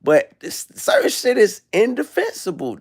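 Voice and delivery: ordinary delivery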